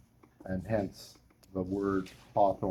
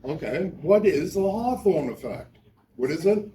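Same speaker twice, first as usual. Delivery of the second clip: quizzing class; 7 semitones higher, 6 dB louder than usual. Real, not acted. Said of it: "let me hear it from you guys".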